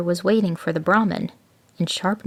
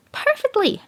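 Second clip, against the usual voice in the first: much higher, smug jackal; grinning. A narrator's reading. Jackal traps the tiger, high and smug. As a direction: let the grin show in the voice. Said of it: "grinned the jackal".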